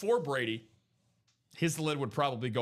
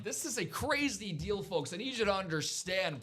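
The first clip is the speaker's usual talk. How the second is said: ordinary talk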